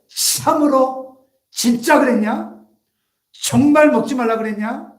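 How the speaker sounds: loud, stretched-out, high-pitched doubting questions; sowing doubt. Acted. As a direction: draw out each question as the tempting devil. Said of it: "의심하게 만드는 거예요".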